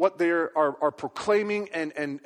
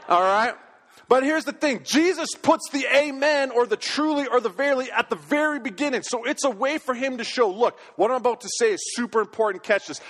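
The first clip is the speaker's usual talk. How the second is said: rising, louder preaching; 4 semitones higher, 3 dB louder than usual. Acted. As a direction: drive the point home to the congregation. Preacher builds to emphasis.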